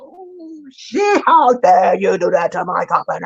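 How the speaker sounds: high, shouted imitation of an outraged mother; furious mother. Mother scolds daughter for disrespecting her guest, shrieking high. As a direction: shriek it like an offended host. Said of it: "the mother got so mad".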